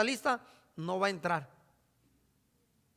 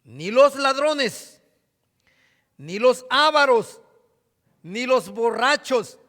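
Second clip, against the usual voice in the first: "No te equivoques" slow and louder, weighty pulpit reading; solemn scripture reading; 7 dB louder, slower than usual. Acted. Preacher warning his congregation, slow louder scripture reading. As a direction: declaim "No te equivoques" slowly, every word heavy.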